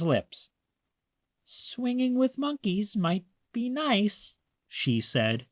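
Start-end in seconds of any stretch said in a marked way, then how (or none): 1.4-4.4 Maya's little voice. A narrator's reading. Maya giving in, higher softer.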